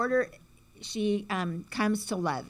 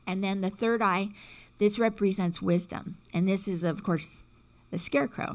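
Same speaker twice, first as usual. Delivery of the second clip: ordinary talk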